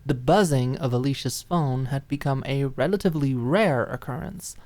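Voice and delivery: plain reading voice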